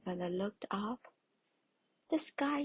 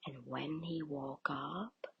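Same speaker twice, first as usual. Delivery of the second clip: ordinary delivery, nothing marked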